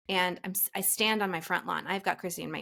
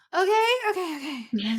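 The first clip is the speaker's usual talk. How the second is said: panicked; 8 semitones higher, 4 dB louder than usual. Retold or imitated. Sent to yet another terminal.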